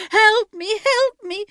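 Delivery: high, loud desperate call; calling for help. Acted. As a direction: cry out to a husband who can't hear. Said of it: "she called".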